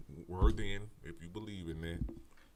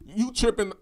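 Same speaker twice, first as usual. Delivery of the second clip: louder and higher, exasperated scolding; heated rant; 12 semitones higher, 12 dB louder than usual. Real, not acted. Scolding nonvoters who won't research, louder heated rant. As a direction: tear into them, fed up.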